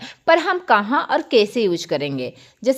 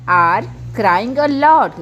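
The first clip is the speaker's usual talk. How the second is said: each word drawn out slowly for students; slow model sentence; much slower than usual. Shown as a demonstration. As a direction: spell it out slowly like a teacher. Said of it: "तो इसलिए हम लोग क्या बोले ना". (बहुत धीरे-धीरे, साफ़ बोला गया वाक्य)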